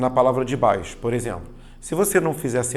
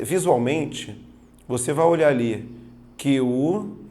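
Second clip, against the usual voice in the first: ordinary talk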